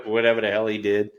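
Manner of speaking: ordinary talk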